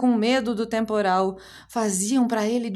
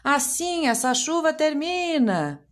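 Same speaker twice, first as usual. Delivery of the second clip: shout; 9 semitones higher than usual. Acted. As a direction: yell it from the curtain rod. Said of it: "A mãe conseguiu gritar".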